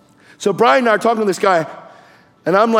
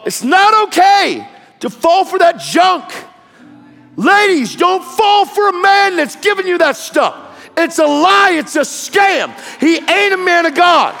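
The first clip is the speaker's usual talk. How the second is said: full-force pulpit call to women; fiery preaching; 9 semitones higher than usual. Acted. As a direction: preach it hard at the ladies.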